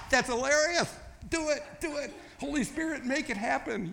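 high-pitched, laughing delight; hammed-up laughing. Preacher playing the Father amused by Jesus's idea, high and laughing. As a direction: crack up, then give orders in a high laughing voice.

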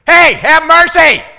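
very loud, high, drawn-out scream for mercy; full-throated yell. Shown as a demonstration. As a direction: scream at the top of your lungs. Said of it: "They did it".

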